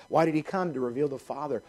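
usual speaking voice

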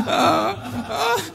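high words broken by laughter; laughing. Real, not acted. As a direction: try to talk through the giggles. Still laughing after joke.

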